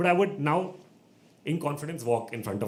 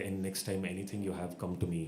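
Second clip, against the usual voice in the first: low, soft imitated offer; baritone official; 4 semitones lower, 6 dB quieter than usual. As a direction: imitate a deep, quiet official. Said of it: "he gets his baritone voice out".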